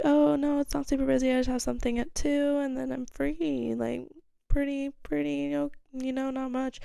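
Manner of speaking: high, put-on casual voice; retold breezy reply. Teller answering match about being busy, high and breezy.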